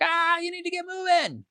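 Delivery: a hammed-up squeaky push to hurry; mock scream. Inner voice hurrying you along, mock yell. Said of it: "screaming at you like".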